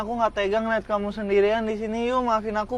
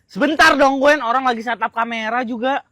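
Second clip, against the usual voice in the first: cross, louder opening; impatient irritation; 2 semitones higher, 5 dB louder than usual. Acted. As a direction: put them off testily. Second take, he is impatient.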